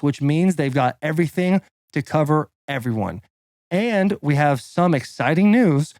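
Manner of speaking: punchy, deliberate announcer-style read; sponsor ad read. Acted. Podcast guest reading a mortgage ad, punchy and deliberate. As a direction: sell it line by line, stressing key words.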